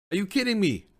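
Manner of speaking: real disbelief, pitch jumping up on 'kidding'; exasperated disbelief. Real, not acted. Player outraged his keeper was benched for the final, exasperated disbelief. Annoyed at manager benching goalkeeper.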